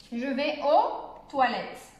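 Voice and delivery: slow, "toilettes" ending on a t sound; silent final S. Shown as a demonstration. French teacher models the plural, "toilettes" slow with silent s. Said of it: "on ne prononce pas le S".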